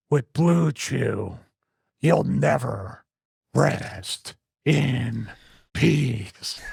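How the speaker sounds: slow, hushed funeral director; drawn out with long pauses; Undertaker voice. Retold or imitated. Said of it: "doing an Undertaker impression".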